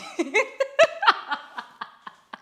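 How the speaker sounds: high, loud cackling laugh; real burst of laughter. Real, not acted. Host caught fibbing about the time, laughing out loud.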